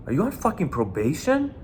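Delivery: high, pointed mock menace; intimidating question. Bouncer pressing a nervous kid, high and menacing. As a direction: lean on him with a sharp, high challenge.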